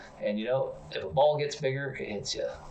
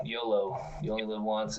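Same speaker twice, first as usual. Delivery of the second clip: ordinary talk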